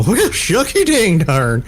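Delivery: put-on slow, drawn-out old prospector. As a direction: drawl it like a grizzled gold miner. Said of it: "I call the Old Prospector".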